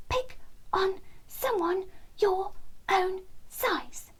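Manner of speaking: high, slow squeaky wren; a tiny bird standing up to a cat; high squeaky defiant wren; high little bird voice. A narrator's reading. Wren Terence confronting cat.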